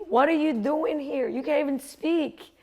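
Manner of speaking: high-pitched, frantic scolding. Acted. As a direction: berate yourself like a panicking inner critic. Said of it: "that voice got louder".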